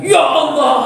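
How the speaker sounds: high wailing cry to God; hammed-up wail of shock; mock crying. Patient hearing he is pregnant, mock wailing.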